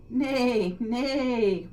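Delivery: high, loud "Nay, nay" like a whinny; whinnying horse. Acted. Horse telling the hen to leave it to him, whinny. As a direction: neigh it like a proud horse. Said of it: "whinnied the horse".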